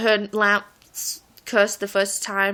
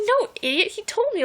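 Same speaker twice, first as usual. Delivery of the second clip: exasperated friend; 11 semitones higher than usual. Retold or imitated. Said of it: "And she's like".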